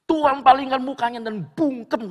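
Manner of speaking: forceful, booming sermon delivery; thundering preaching. Real, not acted. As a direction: proclaim it high and at full force. Preacher thunders about God turning away.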